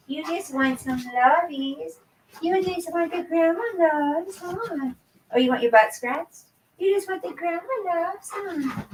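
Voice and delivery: high cooing pet voice. Real, not acted. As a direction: coo at the dog like a grandma. Baby talk to her dog.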